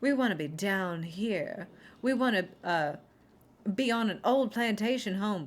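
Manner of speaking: a hint of a Southern belle gushing; mock southern belle. Actors wanting to play it up, mock Southern belle accent. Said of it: "talk to you like a southern belle".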